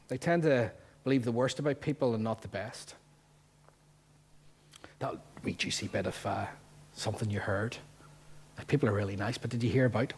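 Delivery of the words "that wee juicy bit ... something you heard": hushed, conspiratorial whisper. Acted. Preacher mimics a gossip sharing a juicy bit, whispering. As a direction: lean in and whisper it.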